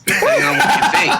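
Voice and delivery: words breaking into laughter; laughing. Real, not acted. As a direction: laugh through the punchline.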